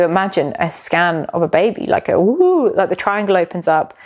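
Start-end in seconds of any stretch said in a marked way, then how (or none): 2.2-2.7 high rising-and-falling "woo" swoop; imitated woo. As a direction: sound out a scan image opening up. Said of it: "I don't know why it went woo".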